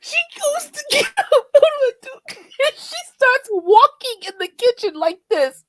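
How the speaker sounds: high, loud, laugh-soaked telling; laughing while telling. Real, not acted. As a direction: fight through laughter.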